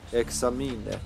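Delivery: "Examine" sounded out as ex-ah-mee-neh; read by the letters. Shown as a demonstration. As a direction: pronounce every letter of "Examine", final e included. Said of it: "just literally read the letters".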